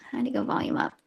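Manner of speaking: muttered under breath; whispered aside. Real, not acted. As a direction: whisper to yourself. Fiddling with phone aside.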